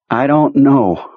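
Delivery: slow, worn-out voice; drained of energy; exhausted. Acted. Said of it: "he sounded exhausted".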